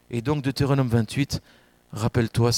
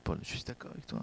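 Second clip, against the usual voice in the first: breathy whisper, much softer, just to Paul; whispered aside; 3 semitones lower, 17 dB quieter, faster than usual. Real, not acted. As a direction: confide it under the breath.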